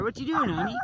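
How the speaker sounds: dog talk. Real, not acted. Talking to his dog.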